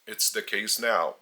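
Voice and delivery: ordinary talk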